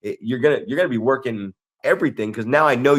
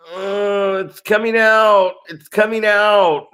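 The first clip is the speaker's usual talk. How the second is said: high, strained alarm, louder on the repeat; a teen in sudden panic from the back seat; panicked kid; 8 semitones higher, 4 dB louder, slower than usual. Retold or imitated.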